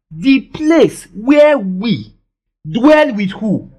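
very slow, stretched scripture line; slow emphatic reading. Real, not acted. Preacher to congregation, slow emphatic scripture reading. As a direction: draw each word out for the congregation.

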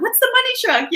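very high, squeaky puzzled voice; squeaky mock question. Host playfully asking about the money truck, squeaky and high. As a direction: ask it in a cartoonish squeak. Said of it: "I was like".

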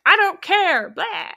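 loud, high mocking cop outburst; aggressive cop. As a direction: imitate a belligerent cop brushing off objections. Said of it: "super aggressive as a cop".

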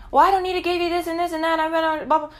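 high, fast mock-mom rattle; mocking mom's excuses. Mom refusing daughter a ride, mockingly imitated.

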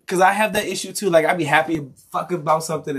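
ordinary delivery

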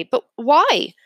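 high and fast pressing; boy pressing his teacher about his friend, anxious; urgent questions. A narrator's reading. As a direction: demand an answer, worried. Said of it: "I look at Miss Blinny and say".

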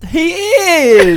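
delighted laughing exclamation; excited laughing. Real, not acted. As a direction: blurt it out through a laugh.

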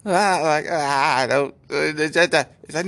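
pained groan. Retold or imitated. John groaning in pain.

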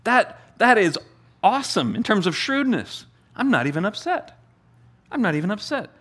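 delighted, unbothered boss; the old master's reaction. Retold or imitated. Master praising the shrewd servant, amused.

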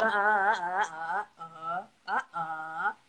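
long, sinking low "ah" sounds; dismayed groan. Acted. Passed-over singles leader seeing everybody getting married, dismayed groan.

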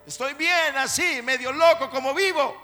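rebellious son yelling. Acted. Son yelling at his praying mother, defiant. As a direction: throw it back at her, fed up.

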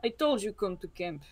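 low, soft, flat-toned surprise; deadpan. Acted. Krzysztof telling Pipistrel she'd been expected, deadpan. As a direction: say it bone dry, no excitement at all. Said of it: "he's always completely deadpan".

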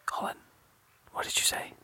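voiceless hushed question; whispering. Real, not acted. Whispered exchange continues.

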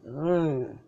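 ordinary delivery

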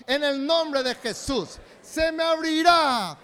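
slow, booming preacher's shout; full-force preaching. Real, not acted. Pastor leading the church in a loud declaration, shouting. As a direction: thunder it like a revival call. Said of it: "bien fuerte".

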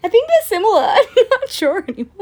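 high, loud, laughing back-pedal; laughing admission. Real, not acted. Defending her accent mix-up to friends, laughing.

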